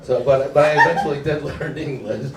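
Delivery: ordinary talk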